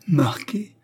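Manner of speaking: high-pitched cry, rising at the end; exclamation. A narrator's reading. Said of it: "s'écria-t-elle". (exclamation aiguë)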